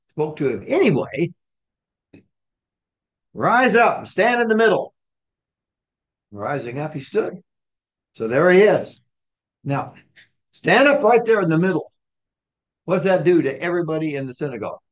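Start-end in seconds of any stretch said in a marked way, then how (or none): 3.3-4.9 high, raised-voice order; a ringing public summons; called-out command. Acted. Acted command of Jesus, called out. 10.5-11.9 higher, louder public order; raised voice, commanding; called-out command. Acted. Acted command, repeated for whole synagogue.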